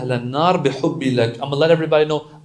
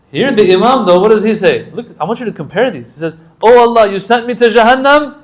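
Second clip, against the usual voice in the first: loud, higher, building sermon; full-force preaching; 8 semitones higher, 11 dB louder than usual. Acted. Preacher contrasting the Imam's prayer, loud and higher. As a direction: drive it home to the crowd.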